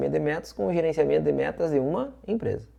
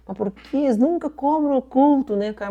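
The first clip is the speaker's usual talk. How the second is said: much higher-pitched voicing of own puzzlement; retold puzzled thought; 13 semitones higher than usual. Teacher retelling the doubt that tripped them up, puzzled. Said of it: "E até pensei assim".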